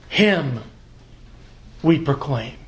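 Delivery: Him set apart, pause, then we proclaim; stress on Him. Shown as a demonstration. Reading the Greek order, Him stressed first. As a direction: hit Him alone, pause before we proclaim. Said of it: "That's the emphasis".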